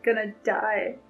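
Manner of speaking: ordinary talk, nothing marked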